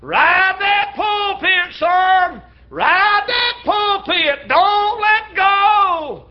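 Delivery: high, slow, drawn-out shout over the storm; shouting encouragement. Retold or imitated. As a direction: call out to him across the waves, stretching every word. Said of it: "by shouting out".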